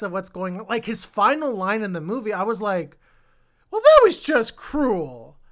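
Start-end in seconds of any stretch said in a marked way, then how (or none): 3.7-5.4 high, loud, drawn-out reaction; mock outcry. Reviewer relives reaction to the film's final line, exaggerated outcry. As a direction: cry it out slowly, high and loud.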